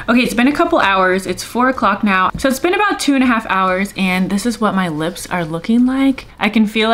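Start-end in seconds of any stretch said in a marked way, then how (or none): none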